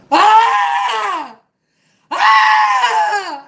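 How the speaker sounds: high, loud screeching fox cry; fox scream imitation. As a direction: scream like a vixen in the night. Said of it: "fox ... going".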